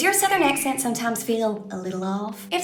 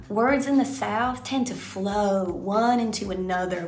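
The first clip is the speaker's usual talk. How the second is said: slow, words blended together; flowing Southern demo; slower than usual. Shown as a demonstration. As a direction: let the words run together like a river. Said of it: "is the flow".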